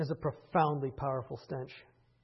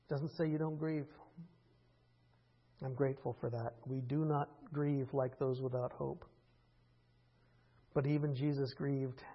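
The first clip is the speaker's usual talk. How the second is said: normal talking voice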